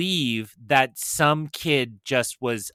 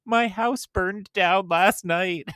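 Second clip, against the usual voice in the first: retold crying; 8 semitones higher, slower than usual. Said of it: "started crying and said".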